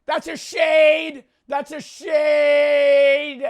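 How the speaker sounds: high, drawn-out yell of protest; mock outraged shout. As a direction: holler it, stretching SHADE out long. Mock outrage over a color answer.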